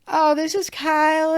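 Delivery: high-pitched mock feminine introduction; imitated hostess introduction. Hostess introducing Kyle to her friends, high-pitched imitation. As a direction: sing it out high, mimicking the hostess.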